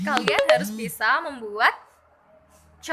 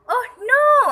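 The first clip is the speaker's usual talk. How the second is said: sympathetic oh no; 11 semitones higher, 9 dB louder than usual. Shown as a demonstration. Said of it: "cara untuk mengatakan I'm sympathy with you".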